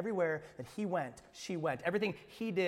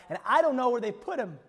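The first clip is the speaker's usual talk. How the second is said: raised, urgent plea of a grieving woman; Mary's plea; 6 semitones higher, 9 dB louder than usual. Acted. Mary answering the angels, urgent and grieving. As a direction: plead through the loss. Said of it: "she replied".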